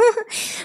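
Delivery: short high-pitched laugh; real little laugh; embarrassed laugh. Real, not acted. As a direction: laugh off the awkward moment. Laughs at her embarrassing story.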